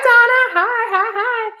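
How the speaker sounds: high-pitched string of hellos; excited greeting. Real, not acted. Host greets Donna joining the stream, high excited squeal. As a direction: squeal hello to an arriving friend.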